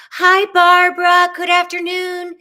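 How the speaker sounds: high, loud sung-out hello; exuberant greeting. Real, not acted. Host greets Barbara in chat, exuberant singsong. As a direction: call it out on a high held note.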